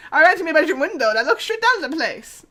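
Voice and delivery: loud, lilting attempt at an Irish accent; bad Irish accent. Witness Willis tells the detective what he did, bad Irish accent. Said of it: "I can't do an Irish accent".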